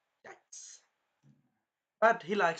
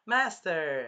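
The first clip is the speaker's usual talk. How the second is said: higher, louder cutesy call; cute girl; 4 semitones higher, 5 dB louder than usual. Retold or imitated. Bird turned girl calling her master, cute imitation. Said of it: "she was very cute".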